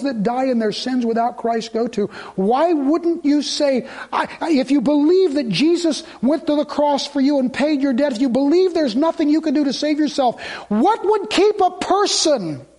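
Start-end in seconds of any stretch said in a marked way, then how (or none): 2.3-12.7 fervent preaching. Real, not acted. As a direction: plead hard with the congregation. Preaching at full force.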